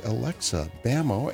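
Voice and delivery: ordinary delivery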